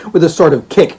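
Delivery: ordinary delivery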